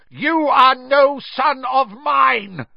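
slow, high, furious bellow. A narrator's reading. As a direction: disown him at full volume. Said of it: "bellowed Mister Crouch".

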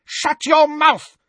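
very high, loud, slow shriek. A narrator's reading. Bellatrix silencing Harry, very high loud shriek. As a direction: scream him silent. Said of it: "Bellatrix shrieked".

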